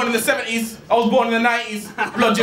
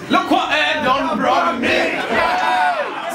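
slow laughing mock shout; mocking taunt; 6 semitones higher, much slower than usual. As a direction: jeer at him, drawn out. Battle rapper mocking opponent.

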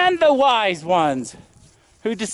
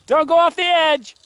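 normal talking voice

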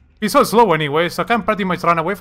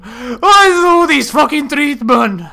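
yelling; 9 semitones higher, 7 dB louder, slower than usual. Real, not acted. Frustrated gamer yelling.

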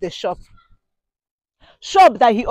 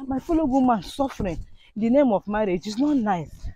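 panting between words; breathless, quiet and winded; out of breath; 3 semitones lower, 7 dB quieter than usual. Real, not acted. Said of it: "Look at how I'm panting".

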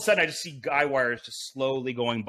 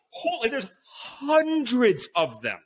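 higher, drawn-out amazement at the snakes; stunned; 7 semitones higher, much slower than usual. Retold or imitated. To the listener, reliving spotting hundreds of snakes, stunned and slow. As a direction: let the awe stretch out slowly. Hiking up among rattlesnakes.

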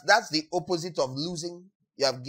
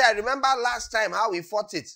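higher, louder bragging recollection; mock veteran boasting of the charge, raised voice; 6 semitones higher, 3 dB louder than usual. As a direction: brag loudly about the old charge. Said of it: "You don't say".

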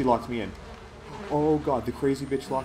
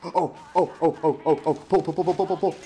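terrified gibbering; 4 semitones higher, 5 dB louder than usual. Real, not acted. Frantic pulling in panic.

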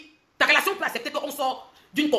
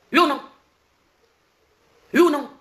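insistent, raised yes-or-no challenge; loud demand; 6 semitones higher, 7 dB louder than usual. Real, not acted. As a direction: demand an answer, hard. Heated demand at a rival.

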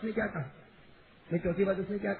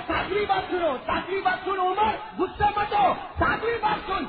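much louder and higher; insistent shout; 8 semitones higher, 6 dB louder than usual. Acted. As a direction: shout him down so he listens. Old man shouts demanding Umar listen.

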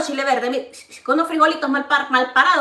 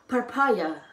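soft English-style papaya, said lower and quieter; 5 semitones lower, 6 dB quieter than usual. Shown as a demonstration. Showing viewers papaya in English, soft and quieter. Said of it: "¿Cómo dice papaya en inglés?".

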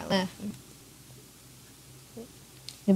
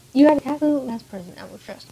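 higher, louder acted rebuke; indignant outburst; 5 semitones higher, 7 dB louder than usual. Noelle rejects Archer's offer of help, indignant outburst. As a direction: throw it out as a raised-voice accusation.

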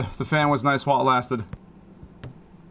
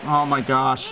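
ordinary talk

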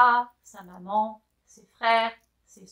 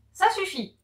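higher, sharp angry snap; angry outburst; 8 semitones higher, 3 dB louder than usual. Acted. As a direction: cut her off impatiently. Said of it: "s'emporte Christophe Colomb".

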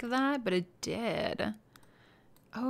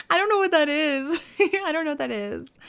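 high, louder words through real laughter; laughing; 7 semitones higher, 6 dB louder than usual. Real, not acted. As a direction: laugh it out in disbelief. Laughing at a glitched item.

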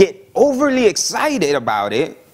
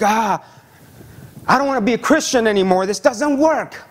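higher, fed-up complaint; frustrated quitter; 5 semitones higher than usual. Retold or imitated. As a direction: throw up your hands and quit. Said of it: "and they're like".

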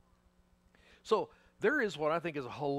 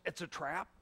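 usual speaking voice

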